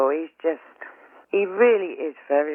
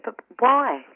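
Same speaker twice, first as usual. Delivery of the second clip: higher, pleading cry of why; a mother begging the other mother for an answer, higher and pleading; distressed pleading; 7 semitones higher than usual. Retold or imitated. Retelling her plea to the mother on the ward, distressed and higher.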